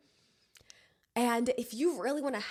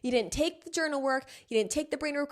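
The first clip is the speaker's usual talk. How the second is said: usual speaking voice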